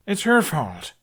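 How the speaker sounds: exploding. A narrator's reading. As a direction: blurt it out all at once in defence. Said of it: "Hiccup exploded".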